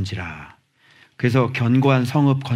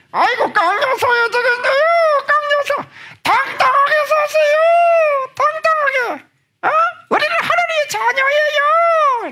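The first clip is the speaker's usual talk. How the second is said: far higher, loud comic squeal; comic high shout; 4 dB louder than usual. Acted. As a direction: squeal it out for a laugh. Shrill comic exhortation.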